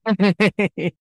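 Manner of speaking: high embarrassed laugh through tears; teary laugh. Real, not acted. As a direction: laugh it off while crying. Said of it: "Sorry, naiyak ako".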